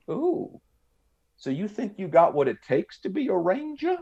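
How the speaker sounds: high, lilting mock Southern drawl. Clerk challenging a would-be ranger, grating Southern drawl. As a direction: size him up in an exaggerated, grating Southern drawl. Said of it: "that Southern drawl accent".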